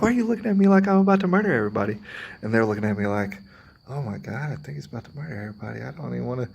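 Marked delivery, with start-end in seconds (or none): 0.0-2.0 much higher, louder and fast, mock indignant; a hammed-up why-are-you-staring protest; exasperated outburst. Retold exasperated question to staring people. 3.9-6.5 mock nervous hush. Imitating nervous onlookers fearing him.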